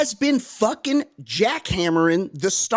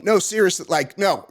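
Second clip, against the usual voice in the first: ordinary delivery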